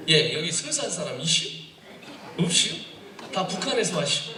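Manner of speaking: lilting Chungcheong question endings; Chungcheong accent. Shown as a demonstration. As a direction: tease the crowd in Chungcheong talk. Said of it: "여기 순산 사람 이슈".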